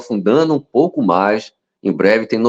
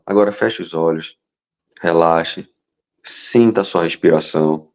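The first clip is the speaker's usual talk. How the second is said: indução de relaxamento; 5 semitones lower than usual. Shown as a demonstration. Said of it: "ninguém tá falando de forma forte". (voz baixa e calma de meditação guiada)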